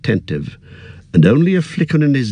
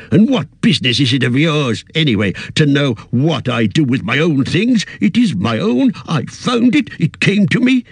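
higher, indignant and possessive; mild angry old hobbit; 7 semitones higher than usual. Acted. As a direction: snap back, defending what is yours. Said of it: "an angry light in his eyes".

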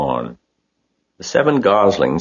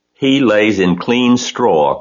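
slow, firm correction, louder and higher; correcting firmly; 6 semitones higher, 5 dB louder, slower than usual. A narrator's reading. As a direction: set her straight, word by word. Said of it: "corrected Mister Zuckerman".

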